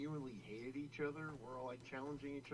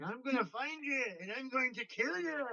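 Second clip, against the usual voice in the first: mock Irish threat; 12 semitones higher, 10 dB louder than usual. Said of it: "like, Irish accent".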